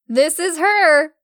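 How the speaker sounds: loud, slow excited announcement; gleeful. A narrator's reading. Stella announces Emma to the man, excited. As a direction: announce it with glee. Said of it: "she giggled out".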